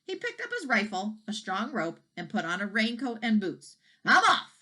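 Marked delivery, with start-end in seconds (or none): 4.0-4.6 loud, higher parting yell. A narrator's reading. Farmer leaving in a huff, loud yell. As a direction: fling it out as the door slams. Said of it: "he yelled".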